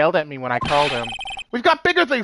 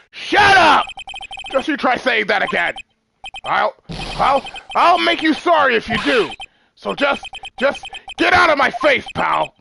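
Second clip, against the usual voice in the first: angry shout; 7 semitones higher, 4 dB louder than usual. Acted. Detective cuts off and threatens.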